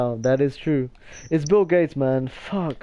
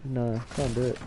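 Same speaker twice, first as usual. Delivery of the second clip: ordinary talk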